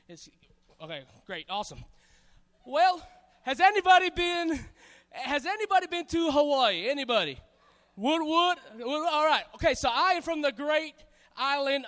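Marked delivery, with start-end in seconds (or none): none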